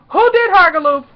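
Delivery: high, loud demanding call; bursting in. Retold or imitated. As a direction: imitate a cop busting through a door. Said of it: "burst out her trailer like she was trying to get a perp".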